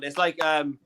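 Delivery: ordinary delivery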